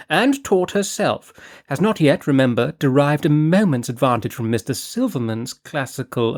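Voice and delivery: breezy, scatterbrained noblewoman chatter; Lady Fareway. Acted. Narrator voices Lady Fareway.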